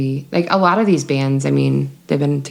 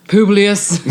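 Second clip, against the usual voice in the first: weird voice; 3 semitones higher, 4 dB louder than usual. Pretend. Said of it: "everybody just do a weird voice".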